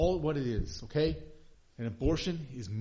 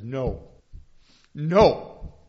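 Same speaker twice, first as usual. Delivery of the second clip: loud, high, swelling voice of God in the psalm; full-force preaching; 10 semitones higher, 11 dB louder, slower than usual. Acted. Pastor voicing God to the congregation, full-force preaching.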